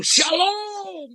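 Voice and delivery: high, drawn-out worship-leader shalom; mock stage greeting. Imitating the worship leader greeting the congregation as the spotlight hits, with mock grandeur.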